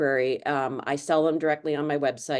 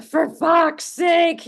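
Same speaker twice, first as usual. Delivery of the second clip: high, loud, slow Irish swear; drawn-out exasperated Irish curse; Irish accent; 13 semitones higher, 8 dB louder, much slower than usual. Retold or imitated. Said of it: "that's our mantra".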